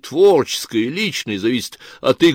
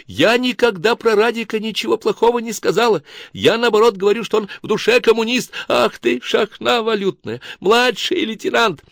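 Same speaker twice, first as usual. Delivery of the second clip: much higher shrill quarrel voice; hysterical sister; 11 semitones higher than usual. Acted. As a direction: screech at your sister. Сестры ссорятся, Кларка визжит.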